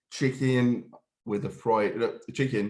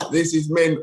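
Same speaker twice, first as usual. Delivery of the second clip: laughing mock boast; 7 semitones higher, 6 dB louder than usual. Laughing, mocking his past self.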